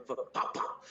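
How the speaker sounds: whispered gunshot noises; shotgun sound. Retold or imitated. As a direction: mouth a shotgun going pop pop. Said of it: "He even got some fucking shotgun".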